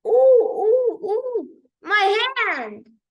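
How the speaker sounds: high, loud ooh ooh of sudden pain; pained cry. Acted. Nurse hurts her hand striking the metal image, loud pained cry. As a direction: yelp as if your hand just hit metal.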